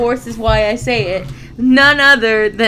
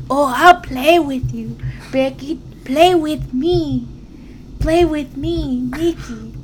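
put-on high, slow sing-song taunt in her accent; Nikki Cross; 4 semitones higher than usual. Fan acting out wrestler taunting Becky, eerie high accented voice. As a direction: mimic a twisted, crazy wrestler, drawn out and high. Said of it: "I like her, I like her voice".

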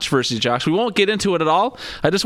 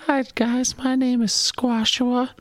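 high squeaky voice mocking his cohost; squeaky slow falsetto impression; squeaky falsetto mockery; 8 semitones higher, much slower than usual. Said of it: "That's not what I sound like".

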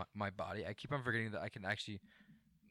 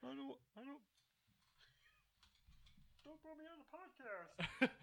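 Kermit the Frog; 8 dB quieter than usual. Acted. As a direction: whimper like Kermit.

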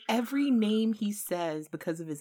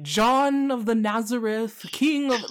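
slow, grand, a touch louder, like addressing royalty; royal introduction; 5 semitones higher, 7 dB louder, slower than usual. Retold or imitated. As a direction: announce your name like a herald, lightly mocked. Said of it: "they're about to be like".

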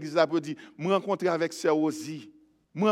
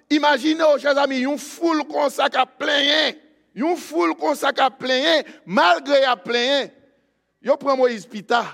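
high, loud sermon delivery; full-force preaching; 8 semitones higher, 8 dB louder than usual. Acted. Preacher telling the church not to fear, full-force preaching.

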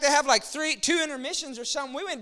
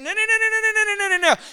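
loud, much higher repeated no; emphatic no; 9 semitones higher, 6 dB louder, faster than usual. Real, not acted. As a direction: shout it down, no, no, no. Emphatic rejection of attitude.

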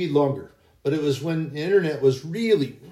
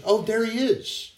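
much higher, eager woman's voice; excited woman; 9 semitones higher than usual. Retold or imitated. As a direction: spot him and squeal it out a bit. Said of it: "And so then she".

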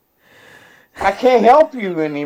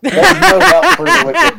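higher, louder mock echo of the cousin; imitating cousin; 10 semitones higher, 4 dB louder than usual. Friend mimicking cousin's remark, higher and louder. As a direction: parrot the cousin's line back, pitched up. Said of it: "that's what your own cousin said".

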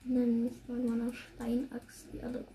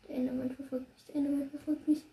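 normal talking voice

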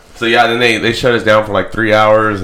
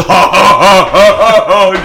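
loud, high hammed-up laugh; mock laughter; 9 semitones higher, 8 dB louder, slower than usual. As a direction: cackle like a drunk chaser. Mimicking drunk chase laughter.

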